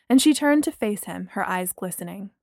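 usual speaking voice

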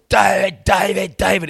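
high, very loud repeated shouts; imitated shrieking. Loud bloke yelling a name in his mate's ear, shrieking. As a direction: bellow the name over and over. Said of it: "He shrieked in my ear".

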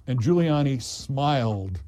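ordinary talk, nothing marked

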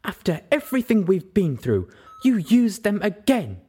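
high and loud, angry reproach; cross near shout. Acted. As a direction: scold her, voice rising. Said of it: "she nearly shouted".

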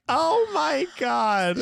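high, drawn-out slow oh my God; shocked realization. Retold or imitated. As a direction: stretch it out in embarrassed shock. Mom realizing the regift.

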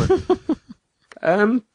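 high, laughing disbelief; incredulous laugh. Real, not acted. Telling his friend about the game, high laughing disbelief. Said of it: "laughs then explains".

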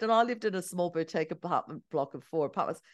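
ordinary delivery

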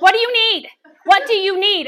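loud, high imitation of annoyed kitchen helpers; irritated. Busy kitchen women to the intruder, loudly irritated. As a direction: demand it in mock exasperation. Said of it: "I have irritated the Marthas".

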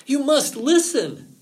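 high, loud, slow insistence; emphatic fox's plea; urgent warning. A narrator's reading. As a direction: demand attention with drawn-out urgency. Fox urgently warns Rabbit.

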